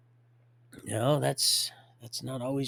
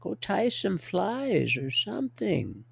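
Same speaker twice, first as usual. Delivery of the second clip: high-pitched mock wife voice; hammed-up high wifely pleading; nagging wife; 7 semitones higher than usual. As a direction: imitate a wife wanting her husband gone. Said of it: "Some of you wives are like".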